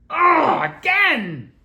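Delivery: high, loud, fed-up cry; loud exasperated outburst. Real, not acted. Streamer irritated by something recurring, loud exasperated outburst. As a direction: blurt it out, fed up it happened again. Annoyed at interruption.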